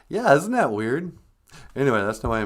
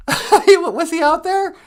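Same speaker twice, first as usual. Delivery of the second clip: grandma voice; 8 dB louder, slower than usual. Acted. High grandmother voice asks.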